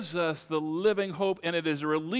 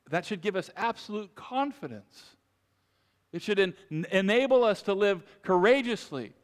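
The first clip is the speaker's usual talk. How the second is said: usual speaking voice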